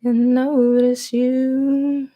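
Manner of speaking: breathy-free slow high sultry purr; slow, high, seductive put-on voice; mock seductive coo. Seductive voice bit after laugh.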